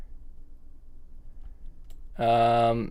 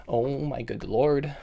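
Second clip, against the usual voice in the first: normal talking voice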